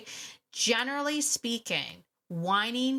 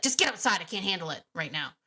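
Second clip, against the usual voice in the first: exasperated; faster than usual. Acted. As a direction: act out losing patience with the dog. Acted owner snapping at the dog.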